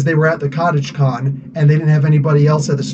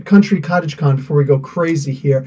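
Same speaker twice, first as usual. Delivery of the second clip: ordinary talk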